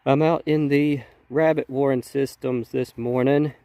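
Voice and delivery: nasal voice from a cold. Real, not acted. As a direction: talk through a stuffed-up nose. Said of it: "pardon my nasally voice".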